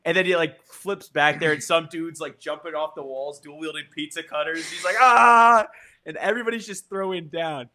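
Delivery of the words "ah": loud, high attack yell; a sudden fighting scream off the wall. Acted. Fighter leaping from the walls, with a loud attack yell.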